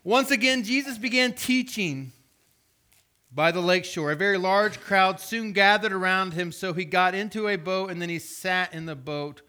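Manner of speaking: lifted, formal reading voice; scripture reading. Acted. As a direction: read it out to the congregation.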